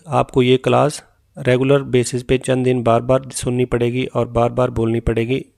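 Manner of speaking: ordinary talk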